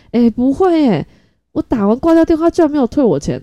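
can't believe it kept the money; indignant surprise. Real, not acted.

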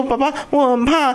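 put-on high-pitched scared child voice; frightened little boy. Terrified son pleading with dad, high mock child voice. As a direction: imitate a small kid pleading in fear. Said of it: "他就很害怕".

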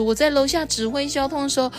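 high, animated policeman character; high-pitched acted report; storybook policeman.

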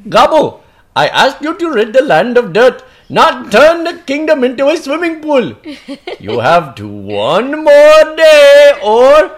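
loud, high royal call; king calling. Acted. The king shouts for Gabu from the mountaintop, loud and high. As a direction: summon your servant across the flood.

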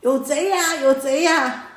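put-on an old thief crying out a false alarm; hammed-up "thief!" call; retold yell. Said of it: "这个父亲呢就大叫".

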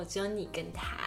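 normal talking voice

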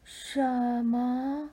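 very slow, drawn-out sloth voice. Acted. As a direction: stretch every word like a sloth. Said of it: "树懒说".

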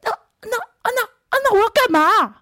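very high, whimpering panic; imitating a whiny, tearful bandmate; mock whining cry. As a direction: ham up a crying bandmate left out. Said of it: "这时候脑包一号大叫".

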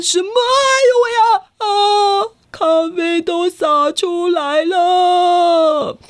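high, loud, then slow wail; coffee drinker yelping as his coffee spills; startled cry. Acted. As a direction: yelp in shock and drag out the dismay.

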